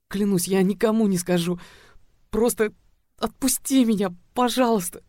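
a trapped cheerleader begging him to let her go, pleading in fear; fearful pleading. A narrator's reading. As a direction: plead for release, scared. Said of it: "тихо умоляла она дрожащим от страха голосом".

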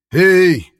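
loud high call; shout. A narrator's reading. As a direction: yell at the door. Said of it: "в голос кричал он".

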